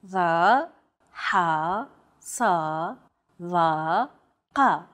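Va, ha, sa, va, qa with tense consonants from deep in the throat; Va, ha, sa, va, qa as isolated Arabic emphatics, throaty and tense. Shown as a demonstration. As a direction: constrict the throat on each of Va, ha, sa, va, qa. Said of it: "much more tense because the throat is constricted".

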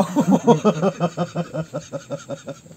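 long laugh trailing away. Real, not acted. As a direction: laugh hard, then let it fade. Tertawa menanggapi jawaban. (tertawa panjang)

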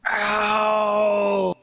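sharp cry of pain; pained yell. Real, not acted. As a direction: yell out as it hurts. Hurt jaw, crying out.

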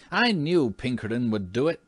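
triumphant. A narrator's reading. Washington shows off to his admiring family, triumphant. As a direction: crow over the clean floor. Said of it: "he exclaimed triumphantly".

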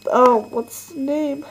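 ordinary talk

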